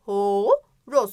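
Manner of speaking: "Horoz" with long loud "hoo", then "roz"; prominent O. Shown as a demonstration. Teacher showing O stands out, long o in "Horoz". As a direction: push out the o sounds in "Horoz". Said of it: "ağzımızdan çok fazla çıkıyor".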